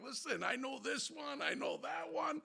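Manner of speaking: high, insistent name-dropping; re-enacted pleading. Driver dropping names to the cop, high pleading. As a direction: plead your connections to the officer.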